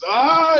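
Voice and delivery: high loud mock scream of pleasure; retold scream. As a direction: cry out high and loud, hamming it up. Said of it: "she's screaming".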